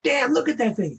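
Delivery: high, amazed exclamation; startled bystander. Retold or imitated. Man getting into his car spots the creature, amazed. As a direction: gawk at it in disbelief. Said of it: "somebody else running and is getting in his car said".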